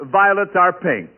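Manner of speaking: normal talking voice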